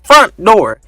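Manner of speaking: loud flat announcement; alarm voice. Retold or imitated. Door alarm announcing the front door, loud and flat. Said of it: "they're just like".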